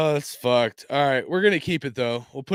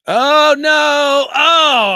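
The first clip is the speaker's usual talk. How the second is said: loud, high cry of dismay; dismayed shout; 13 semitones higher, 12 dB louder, much slower than usual. Real, not acted. Vlogger shouting at a card he hates, a loud cry of dismay. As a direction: howl in mock horror at the card.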